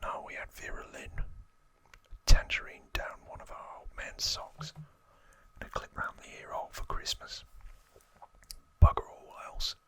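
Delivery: lightly acted Cockney gangster, whispering; Cockney accent. Gangster reminiscing about childhood with Archie, whispered Cockney accent. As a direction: whisper a put-on Cockney grumble. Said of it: "That's my best Cockney accent".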